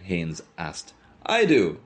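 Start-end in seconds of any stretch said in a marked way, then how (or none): 1.2-1.8 high, loud quick answer; raised voice, high and emphatic; shout. A narrator's reading. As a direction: shout it out, pitched up. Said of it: "Mulligan shouted".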